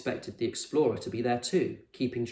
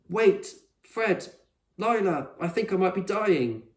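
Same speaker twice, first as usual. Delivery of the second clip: much higher, urgent and winded; dramatic alarm; 8 semitones higher, 5 dB louder than usual. A narrator's reading. Con doubled over, fearing she's dying. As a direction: plead as if in danger.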